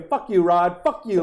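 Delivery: high-pitched mock outrage; imitated fury. Furious fan cursing Rod at his announcement, imitated anger. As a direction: curse him out like an outraged fan. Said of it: "Like, she's so mad".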